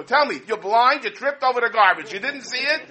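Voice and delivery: hammed-up shout at him; mock scream from window. Wife yells from window at husband, mock scream. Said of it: "she's screaming from the window".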